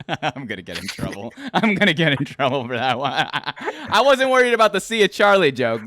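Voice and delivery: talking through real laughter; laughing. Real, not acted. Host laughing about a risky joke, laughing. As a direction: giggle out the worry about trouble.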